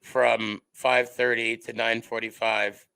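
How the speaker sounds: low, level droning; monotone. Retold or imitated. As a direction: imitate a teacher who never changes pitch. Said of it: "I talked like this for the whole time".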